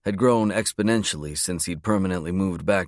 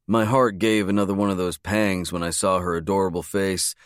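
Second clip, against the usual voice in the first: ordinary delivery, nothing marked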